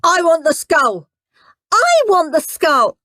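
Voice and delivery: loud, high, insistent demand, slowing at the end. Acted. Henry demanding the skull game piece. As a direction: hammer the demand. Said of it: "said Horrid Henry, glaring".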